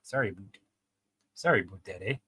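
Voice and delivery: repeated attempts at Canadian "aboot"; amateur Canadian vowels on "about"; Canadian accent. Shown as a demonstration. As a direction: rehearse a shaky-skilled Canadian accent. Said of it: "do a Canadian accent".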